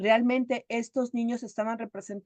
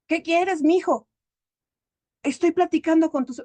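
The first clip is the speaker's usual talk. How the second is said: higher, louder acted irritation; annoyed mother; 6 semitones higher, 6 dB louder than usual. Mother interrupted while chatting with her children, annoyed. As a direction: brush the child off, raised voice.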